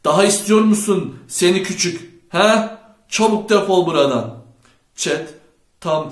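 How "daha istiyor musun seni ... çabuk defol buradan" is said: loud, high angry shouting; furious, raised-voice challenge; angry threat. A narrator's reading. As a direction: bellow threats at a beaten rival. Chet threatens Knox in a fight.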